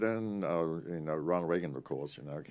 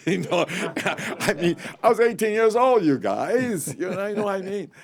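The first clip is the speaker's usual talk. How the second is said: much higher and louder, laughing through it; laughing excitedly; 13 semitones higher, 11 dB louder than usual. Real, not acted. Telling the interviewer about being eighteen, laughing. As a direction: laugh out the memory, high and loud.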